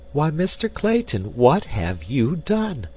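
high-pitched, drawn-out dismay; astonished cry. A narrator's reading. Jane to Clayton over his lavish spending, crying out. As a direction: cry out high, stretching the question. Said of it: "cried Jane Porter".

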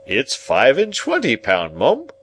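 pompous echo lifting high on the last word; self-important confirmation; echoing Pumblechook. A narrator's reading. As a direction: repeat it as if it were your own news. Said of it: "echoed that basest of swindlers, Pumblechook".

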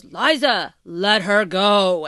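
firm mock command, last words stretched out; Littlefinger, straight voice. Littlefinger stepping in at the moon door, straight voice. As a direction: imitate a straight-up, commanding schemer. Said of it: "Littlefinger straight voice".

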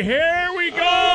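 drawn-out booming intro; slow announcer shout. Acted. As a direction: stretch it out like a show announcer. Announcing the segment.

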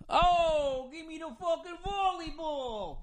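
high, squealing meathead yell mocking jocks; mock meathead voice, high and grabby; dumb beach jock. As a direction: whine it like a dim beach bro begging for the ball.

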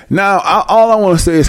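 normal talking voice

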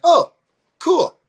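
very high, mock pleased surprise; pleasant surprise. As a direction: imitate someone suddenly appeased, pitch leaping up. Venue guys receive cash, retold.

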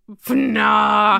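a loud schoolboy "fnah" snort; comic innuendo snort. Pretend. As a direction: shout the snigger at the double meaning. Mocking the innuendo.

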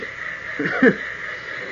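unvoiced airy chuckle at the stubborn engine; a quick breathy laugh of relief; short breathless laugh. Laughing as jalopy sputters to start.

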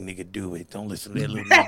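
low, soft imitation, fast at the end; a hint of a demon pushing him; mock devilish boss. Employee voices boss urging him on, low and devilish.

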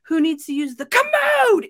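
mock screech on the last word; mock grandma scream. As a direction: build to a comic yell on commode. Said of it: "And she would scream".

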